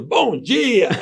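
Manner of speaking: lightly imitating hotel staff in chorus; chorus reply. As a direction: echo it back like a group of staff. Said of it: "Eles responderam em coro".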